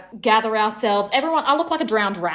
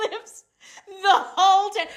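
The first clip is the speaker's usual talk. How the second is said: ordinary talk, nothing marked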